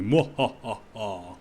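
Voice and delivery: slow drawn-out villain cackle; evil laugh. Pretend. Player roleplays assassin villain.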